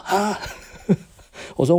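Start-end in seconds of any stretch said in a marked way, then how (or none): 0.1-0.4 shocked huh. Retold or imitated. Supplier shocked by reorder.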